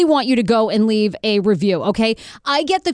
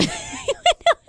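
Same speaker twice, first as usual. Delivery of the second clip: a burst of high, giddy laughter; high giggle. Real, not acted. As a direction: laugh at your own cheeky joke. Laughing at own joke.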